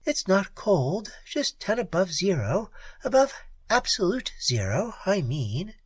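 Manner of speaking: a hint of a teasing jibe; jibing. A narrator's reading. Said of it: "she jibed".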